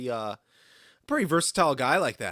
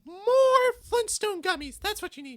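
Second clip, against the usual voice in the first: very high, squeaky cartoon child; Mickey Mouse kid voice. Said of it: "you sound like Mickey Mouse".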